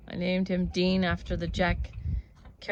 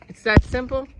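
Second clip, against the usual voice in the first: loud, higher, stunned disbelief on simple; amazed question; 5 semitones higher, 9 dB louder than usual. Retold or imitated. Asking the dead cousin, louder in retold disbelief. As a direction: ask it as if it can't be that easy. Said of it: "I said".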